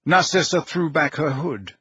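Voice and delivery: ordinary delivery, nothing marked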